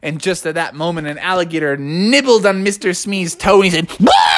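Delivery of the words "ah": very high cartoon scream; yelp of pain. A narrator's reading. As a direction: scream as if bitten on the toe. Alligator bites Smee's toe.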